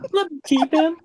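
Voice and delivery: high hammed-up pleading; mock whimper. Narrator whimpering to keep Richard. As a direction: beg like a child for a pet. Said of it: "I said, whimpering".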